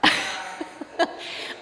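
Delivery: real laugh bubbling back up; leftover laughter. Real, not acted. Preacher trying to resume the sermon, laughing. Still laughing at own pun.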